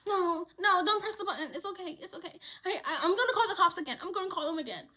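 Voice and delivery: high-pitched, flustered imitation; scared coworker. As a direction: mimic a jittery coworker trying to calm things. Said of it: "Messy, she's scared".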